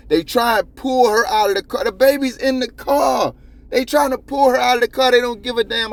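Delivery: loud, high-pitched indignation; outraged. Real, not acted. Host decrying troopers pulling a mother from her car, outraged. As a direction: raise your voice in disbelief at what they did.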